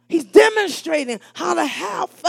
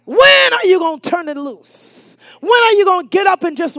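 preaching shout; 4 semitones higher, 7 dB louder than usual. Real, not acted. Preacher challenging the congregation.

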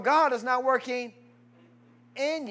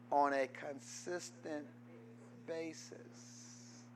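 soft repetition; 6 semitones lower, 10 dB quieter than usual. Shown as a demonstration. Preacher repeating the verse softly so it soaks in, quiet and low. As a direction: let it sink in gently. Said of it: "Let that soak in".

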